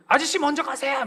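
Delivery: put-on high cheeky child reply; kid's voice. Boy telling the dad to go first. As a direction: answer the adult like a smug kid.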